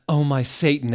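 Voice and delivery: hammed-up startled scream. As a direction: yelp it in a panic. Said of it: "we screamed".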